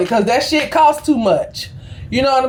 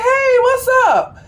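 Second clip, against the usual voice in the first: 1 cheery greeting; 13 semitones higher than usual. Retold or imitated. Cheerful greeting to grumpy clerk.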